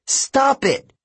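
very high loud outburst; shout. A narrator's reading. Annabeth shouting at Luke to stop, very high. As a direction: cut him off with a cry. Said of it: "she shouted".

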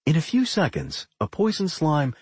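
ordinary delivery

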